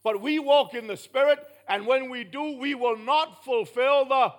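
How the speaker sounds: forceful, real pulpit volume; loud emphatic preaching. Real, not acted. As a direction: hammer the point home loudly. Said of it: "Am I too loud? No. I'm just trying to emphasize a point".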